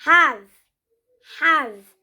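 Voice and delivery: "have" said short and firm; "have" with a clean V ending; model pronunciation. Shown as a demonstration. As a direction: announce "have" clearly. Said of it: "the third one is".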